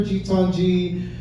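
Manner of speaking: ordinary talk, nothing marked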